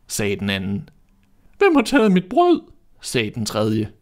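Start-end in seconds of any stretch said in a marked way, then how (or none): 1.6-2.6 much higher, louder little dwarf squeak; a shrill-pitched little dwarf asking who took his bread; squeaky dwarf. A narrator's reading. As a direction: pipe it up like a tiny upset dwarf. Third dwarf, very high.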